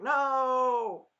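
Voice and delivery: high, loud, long no; mock scream. Daughter screaming in her dream sequence, loud mock scream. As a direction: cry out a drawn-out imitated scream. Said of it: "basically screaming like".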